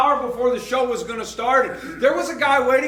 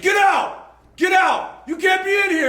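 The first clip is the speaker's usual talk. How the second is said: retold yell; 8 semitones higher than usual. Wrestlers ordering the pastor out of the room, mock yelling. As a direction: bark them out like an angry wrestler. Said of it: "they said".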